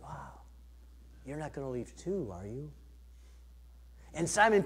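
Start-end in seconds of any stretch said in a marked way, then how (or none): 0.0-2.7 much softer, hurt near-murmur; Jesus turning sadly to his disciples, very soft and hurt; hurt and disappointed. Retold or imitated. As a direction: let it sink, quiet disappointment. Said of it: "probably frustrated or hurt".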